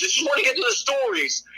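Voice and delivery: high, whiny mock-viewer plea; imitated nagging complaint; whining. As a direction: whine it like an impatient kid. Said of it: "people whine because".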